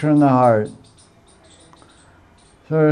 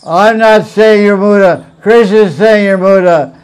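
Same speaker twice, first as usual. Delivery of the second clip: Prabhupada's retort, louder and higher; loud retold retort; 4 semitones higher, 7 dB louder than usual. Teacher acting Prabhupada answering the Indian gentleman, loud. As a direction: fire back the line like the guru did. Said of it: "I heard Prabhupada say".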